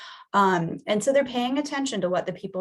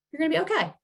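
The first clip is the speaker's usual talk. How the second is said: higher, soothing "okay"; gentle reassurance; 9 semitones higher, much faster than usual. Shown as a demonstration. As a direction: comfort a nervous kid. Reassuring the scared child.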